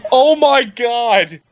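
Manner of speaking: loud, slow shocked exclamation; stunned by what she just read. Real, not acted. Reacting to the fanfic line.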